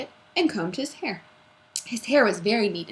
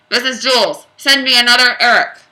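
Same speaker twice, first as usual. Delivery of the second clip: loud announcement into the mic; loud intercom call; over the microphone; 5 semitones higher, 11 dB louder than usual. Acted.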